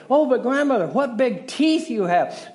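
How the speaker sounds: hammed-up little girl in fright. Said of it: "I really get into these stories".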